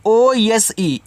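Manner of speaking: T H O S E spelled slowly letter by letter; drawn-out classroom spelling. Shown as a demonstration. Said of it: "इसको पढ़ते हैं दोज".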